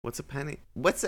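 ask, totally lost, rising high; puzzled cook squeaking up; baffled question. Acted. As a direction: pitch jumping up in confusion. Confused about penny apple.

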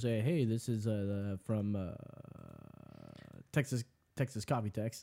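low, soft mumble with hesitant uhs; imitating pilot-like coworker. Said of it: "dude, who totally talks like that".

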